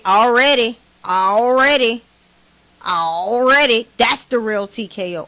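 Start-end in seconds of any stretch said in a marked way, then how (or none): 0.0-3.9 louder mock of his "Already"; imitating T K O. Mocking TKO's catchphrase to viewers, louder imitation. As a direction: parrot the rival's catchphrase, louder and slowing on the last. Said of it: "You know how T K O say".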